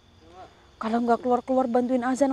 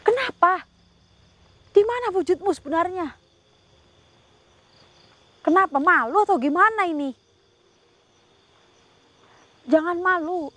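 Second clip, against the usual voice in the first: high, raised coaxing questions; coaxing call; 7 semitones higher, 3 dB louder than usual. Real, not acted. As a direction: coax the shy spirit out, voice lifted high. Coaxing the spirit to show itself.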